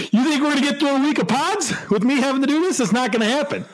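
high-pitched, laughing disbelief; incredulous protest. Real, not acted. Balks at doing the accent.

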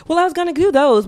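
whiny student. Retold or imitated. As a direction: plead like a slacking student. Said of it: "And you're like".

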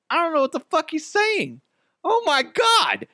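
very high, strained comic bewilderment; baffled mock panic. Traveler lost at Australian customs, hammed-up high-pitched panic. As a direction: squeal it in exaggerated confusion.

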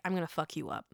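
low, soft mock menace; imagined quiet threat. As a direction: say the threat softly, like you mean it. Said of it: "be able to do it herself and be like".

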